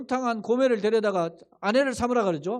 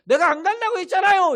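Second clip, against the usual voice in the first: higher, louder, stress on 간다고 in 간다고 했잖아요, falling; Jonah's indignant protest; 10 semitones higher, 8 dB louder than usual. Retold or imitated. Jonah protesting to God that he refused, indignant with stress on 간다고.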